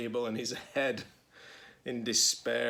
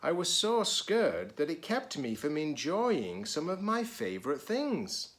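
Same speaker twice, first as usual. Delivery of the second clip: lively, higher storybook reading voice; reading to children; 6 semitones higher, 3 dB louder than usual. A narrator's reading. Teacher reading a picture book to children, lively. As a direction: read it brightly to the kids.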